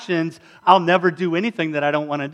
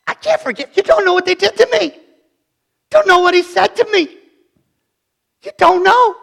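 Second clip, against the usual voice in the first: much higher, louder, strained pleading; bitter wounded objector; 7 dB louder than usual. Acted. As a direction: insist nobody understands the hurt. Voicing bitter hurt objector.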